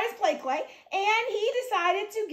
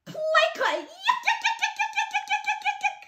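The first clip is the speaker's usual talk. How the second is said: squeaky, high-pitched chant of yuck; disgusted yell; 13 semitones higher than usual. A narrator's reading. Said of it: "And she yelled".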